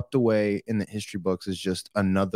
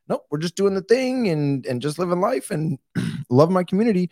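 higher-pitched mock small-town townsfolk; small-town local; 7 semitones higher than usual. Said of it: "small towns where everyone's just like".

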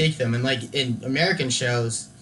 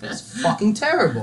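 high-pitched mock British chef; put-on British accent for a scathing verdict; Gordon Ramsay; 7 semitones higher than usual. TV chef judging a dish, mock British disgust. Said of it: "Gordon Ramsay has a British accent".

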